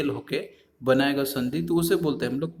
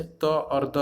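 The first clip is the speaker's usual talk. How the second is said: slow, deliberate letter names; much slower than usual. Shown as a demonstration. Teacher sounding out letters.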